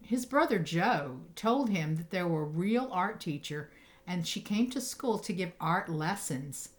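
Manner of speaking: usual speaking voice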